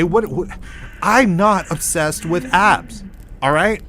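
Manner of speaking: indignant comic outburst; exasperated protest. Acted. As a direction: push back hard, spacing out the words. Comic rant at his doppelgangers.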